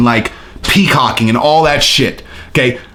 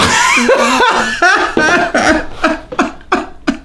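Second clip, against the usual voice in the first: a long, high, giddy laugh. Real, not acted. Laughing at a rival's taunt.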